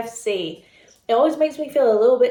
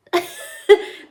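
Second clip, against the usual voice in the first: high breathy laugh; 10 semitones higher, 4 dB louder than usual. Real, not acted. Laugh at dry pickles.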